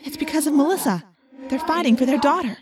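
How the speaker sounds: excited echoing realization; urgent explanation. A narrator's reading. Narrator explaining the parents' fight to Jake, urgent.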